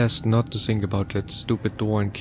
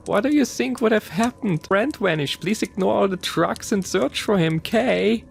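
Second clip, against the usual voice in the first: high mocking voice; 11 semitones higher than usual. A narrator's reading. Chen mocking, still laughing.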